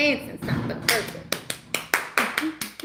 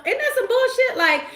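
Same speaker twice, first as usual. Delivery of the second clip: ordinary talk, nothing marked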